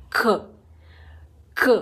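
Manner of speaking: ख as a k/kh mix from the throat; throaty c sound. Shown as a demonstration. Teacher showing the letter c sound, ख mixed from the throat. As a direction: breathe a bit of throat into ख. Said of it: "वो दोनों का mix रहता है".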